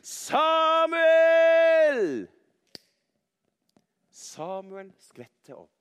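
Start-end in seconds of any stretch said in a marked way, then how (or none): 0.0-2.3 loud, high, long-held call; Gud calling out. A narrator's reading. Storyteller as the voice waking Samuel, loud drawn-out call. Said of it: "så kan man høre stemmen".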